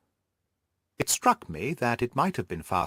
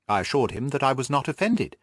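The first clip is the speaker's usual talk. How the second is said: ordinary delivery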